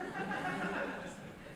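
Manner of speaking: high breathy laugh at his own joke; burst of laughter. Real, not acted. Speaker laughing at his own joke, amused laughter.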